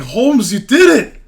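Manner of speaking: high, loud excited cry; loud, high-pitched exclamation; excited Hobbs. Acted. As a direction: yell it out, thrilled, pitch soaring. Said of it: "Hobbs is like".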